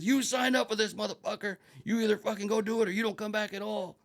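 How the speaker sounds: high, forceful shouted retort; angry ultimatum at a yell; drill instructor yell. Retold or imitated. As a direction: imitate an instructor chewing out a recruit. Instructor yelling back at protesting recruit.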